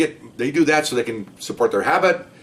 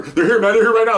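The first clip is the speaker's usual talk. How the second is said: urgent alert; 9 semitones higher, 4 dB louder than usual. Retold or imitated. Urgent radio alert retold.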